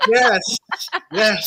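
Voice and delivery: high, loud mock acceptance; put-on yes. Acting out her obligated answer on the Jumbotron, put-on loud yes. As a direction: exclaim a forced public yes.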